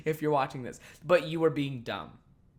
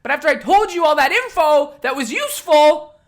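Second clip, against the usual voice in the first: much higher and louder, building to a yell; furious; 14 semitones higher, 14 dB louder than usual. Real, not acted. Accusing the selfish customer, a furious building yell. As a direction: hammer home that she is selfish. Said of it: "But I was fucking angry".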